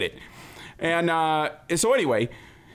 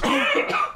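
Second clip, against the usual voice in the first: sharp imitated gasp; sudden mock-shocked intake of breath; 10 semitones higher than usual. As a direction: gasp as if scandalized. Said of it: "he gasps".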